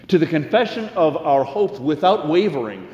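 earnest, rising preacher's cadence; sermon delivery. Acted. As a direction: preach it to the congregation.

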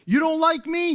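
high, hurt, mocking jab; lightly acted tit-for-tat; mock offended voice. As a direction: play the petty aggrieved world. Voicing the world's tit-for-tat.

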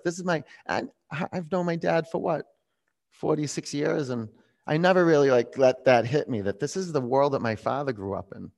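usual speaking voice

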